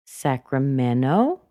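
slow "Sacramento", lifted at the end; rising final syllable on "Sacramento"; rising intonation. Shown as a demonstration. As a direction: let "Sacramento" climb as if continuing. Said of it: "There's another one".

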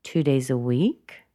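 "week" swooping up at the end; unfinished upturn on "week"; rising intonation. Shown as a demonstration. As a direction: lift "week" as if continuing. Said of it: "she went up in intonation".

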